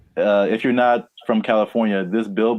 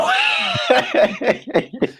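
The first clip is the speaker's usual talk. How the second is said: high squealing laugh. Real, not acted. Co-host squeals with laughter at the freeway joke. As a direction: burst into a shrill, squealing laugh.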